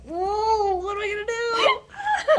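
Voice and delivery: high, loud, laughing panic; panicked high wail with a laugh; mock panic. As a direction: squeal it like you're caught out. Panicked self-quote on set.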